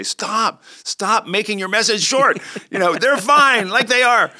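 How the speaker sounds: high, loud, playful protest; mock exasperated plea. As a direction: plead in mock exasperation, pitch jumping up.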